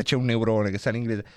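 usual speaking voice

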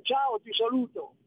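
usual speaking voice

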